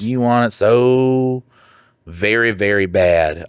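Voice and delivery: slow, savouring mockery; slow teasing drawl. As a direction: drag out so and very very, taunting.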